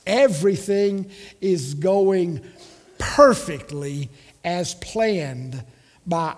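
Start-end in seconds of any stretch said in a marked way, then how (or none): none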